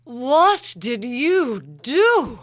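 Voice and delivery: slow, loud, high sultry drawl; vamp. Pretend. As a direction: vamp it up, stretched out and big. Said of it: "all of a sudden a quiet Irish person".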